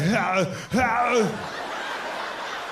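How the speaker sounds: long, drawn-out mock grunt; imitated action-hero grunt. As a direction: groan it like a movie action hero. Imitated Schwarzenegger-style grunt as bedroom noise.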